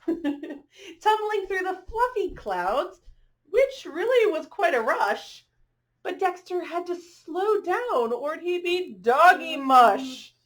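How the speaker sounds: bright, rhythmic picture-book read-aloud; reading to children. A narrator's reading. Reading a rhyming dog story to children, lilting storyteller voice. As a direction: read the rhyme to the little ones.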